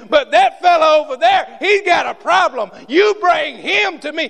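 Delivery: high, loud ringing command; Jesus commanding. Acted. Jesus commanding the crowd to bring the man, shouted. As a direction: call out the order over the crowd. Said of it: "and he said".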